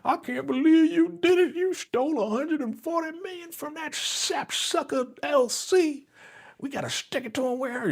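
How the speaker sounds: gushing Donna. Retold or imitated. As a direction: coo it like a proud, scheming mama. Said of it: "and she like".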